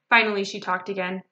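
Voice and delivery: ordinary delivery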